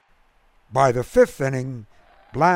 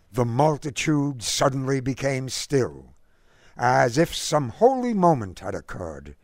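ordinary delivery, nothing marked

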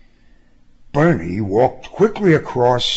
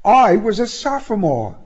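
high-pitched, amused recollection; tickled woman's voice; laughingly delighted; 11 semitones higher than usual. A narrator's reading. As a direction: keep it high and merry.

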